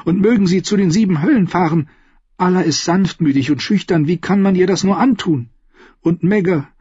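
lightly voiced indignant young queen. (junge Königin, leicht empört gesprochen)

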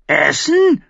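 very high, loud squawk; screeching raven. A narrator's reading. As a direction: shriek it like a bird. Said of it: "kreischte der Rabe".

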